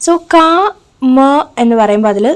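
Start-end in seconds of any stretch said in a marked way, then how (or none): 0.3-1.2 "ക, മ" as two separate syllables with a pause between; split into syllables. Shown as a demonstration. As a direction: say "ക", pause, then "മ". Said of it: "രണ്ട് syllables ഉണ്ട്".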